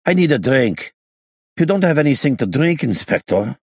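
put-on high, accented, aggrieved voice; needy gangster. A narrator's reading. Freed gangster demanding a drink from the inspector, high aggrieved voice. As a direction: plead crossly in a high foreign accent.

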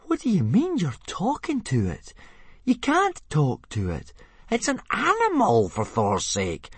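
high-pitched, scandalised astonishment; astonished protest. A narrator's reading. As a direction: object in sheer disbelief, voice shooting up. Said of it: "gasped Fishlegs in astonishment".

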